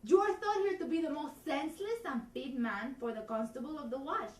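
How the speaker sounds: put-on loud, theatrical Spanish accent with feeling; Spanish accent, as a woman. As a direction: play it big in a comic Spanish accent. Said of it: "Do it in Spanish again".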